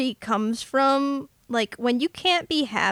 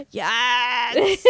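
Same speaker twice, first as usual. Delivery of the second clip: excited gasp; 4 semitones higher than usual. Real, not acted. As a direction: gasp and shout your delight. Excited agreement.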